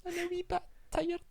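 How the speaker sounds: squeaky old Scottish granny impression; old Scottish woman. Imitating friend's parent, falsetto old Scottish woman. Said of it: "like an old Scottish woman".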